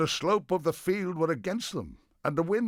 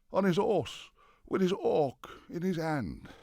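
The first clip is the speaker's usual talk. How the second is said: high, lifted storyteller's delivery; raised-pitch refrain; 5 semitones higher than usual. A narrator's reading. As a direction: keep the refrain up high as tension builds. Refrain as Harold is left alone.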